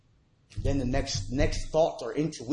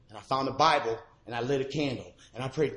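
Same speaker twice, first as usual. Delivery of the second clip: usual speaking voice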